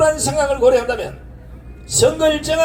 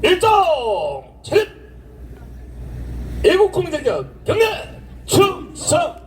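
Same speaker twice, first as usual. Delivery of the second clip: drill sergeant. Real, not acted. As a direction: call the ranks to attention. Ceremony commands, salute. (구령 붙이는 목소리)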